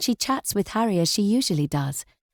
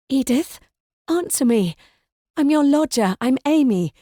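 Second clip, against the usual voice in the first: louder, urgent, pleading to be recognised; alarmed, raised voice; 5 semitones higher, 4 dB louder than usual. Acted. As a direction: shake her into answering, voice raised. Amy confronts Edith, alarmed.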